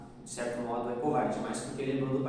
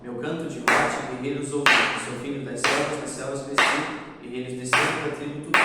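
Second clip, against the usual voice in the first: louder verse hitting each line's final stress; beat on the last stressed syllable; 5 dB louder than usual. Shown as a demonstration. Teacher shows the clap on each final stress, louder. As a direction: punch the last tonic syllable of every line. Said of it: "Então, ó".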